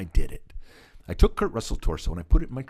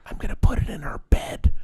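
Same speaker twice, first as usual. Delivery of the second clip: re-enacted stage whisper; conspiratorial; 7 semitones higher than usual. Dad tells son where the torso goes, gleeful conspiratorial whisper. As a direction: whisper it like a secret plan.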